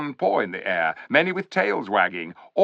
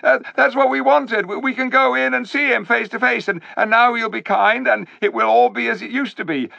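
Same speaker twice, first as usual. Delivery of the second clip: high, hopeful stammering; eager crowd; 13 semitones higher, 6 dB louder than usual. A narrator's reading. Beasts hoping to see Aslan face to face, eager and high. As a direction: babble with hope.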